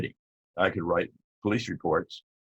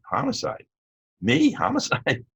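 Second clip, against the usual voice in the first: can't believe what he's hearing; stunned disbelief. Retold or imitated. Offered a homicide job.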